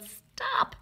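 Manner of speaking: sharp high yelled stop; parents yell stop. A narrator's reading. Parents shouting at climbing Ada: high sharp stop. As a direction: cry out to halt the climbing girl. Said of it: "Her parents yelled".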